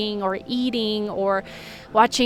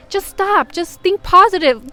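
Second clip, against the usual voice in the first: high, louder imitation of useless advice; mock scolding adult; 9 semitones higher, 6 dB louder than usual. Adult telling a toddler to stop, mock chiding. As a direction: parody a grown-up hushing a toddler.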